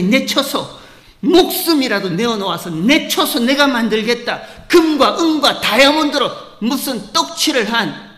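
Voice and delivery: loud, ringing sermon with a laugh in it; full-force preaching. Acted. Pastor mocking self-made heavenly mansions, loud preaching. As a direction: preach loud, poking fun at grand plans.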